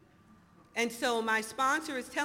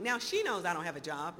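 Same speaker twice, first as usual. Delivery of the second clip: ordinary talk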